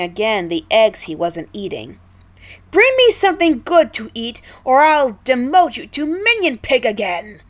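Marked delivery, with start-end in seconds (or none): 2.7-7.4 high, loud commanding snap; angry snap. A narrator's reading. King Pig snapping at Chef Pig. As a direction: threaten the chef sharply. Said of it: "he snapped".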